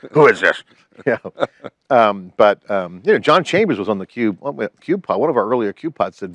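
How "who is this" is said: muffled voice. Pretend. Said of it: "muffled voice".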